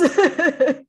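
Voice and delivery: short burst of laughter. Real, not acted. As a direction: laugh out loud. Laugh after own remark.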